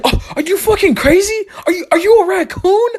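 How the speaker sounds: high, laughing-crying shriek of disbelief; mock outraged shriek. Son shrieking at mom for digging through trash, laugh-crying. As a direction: shriek at her half laughing, half wailing.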